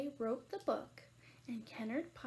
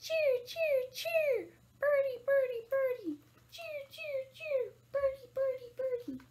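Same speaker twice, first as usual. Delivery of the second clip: high, loud falling whoops; imitating a cardinal's repeated cry; cardinal call; 11 semitones higher, 10 dB louder, slower than usual. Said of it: "There's our cardinal again".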